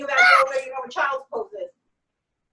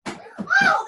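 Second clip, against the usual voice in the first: sudden high yelp of effort; pained exclamation. Real, not acted. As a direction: cry out as the burn hits. Strained by the workout.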